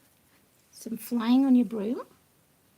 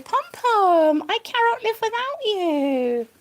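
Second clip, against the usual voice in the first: slow tender love note to a pet rabbit; 6 semitones higher, 5 dB louder, slower than usual. Real, not acted. Repeating card message tenderly.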